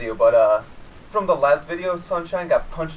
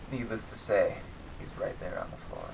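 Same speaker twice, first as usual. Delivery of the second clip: ordinary talk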